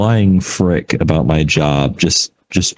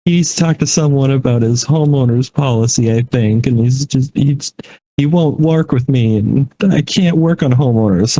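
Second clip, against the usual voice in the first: lightly imitated upset agent, higher pitched; crumbling on phone; 7 semitones higher than usual. Agent apologizing about a difficult customer, mildly crumbling. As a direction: plead apologetically, as a retold overwhelmed caller. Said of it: "she was just crumbling on the phone".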